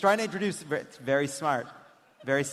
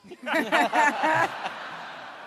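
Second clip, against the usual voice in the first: high laugh; 11 semitones higher than usual. Real, not acted. Laughs at own bit.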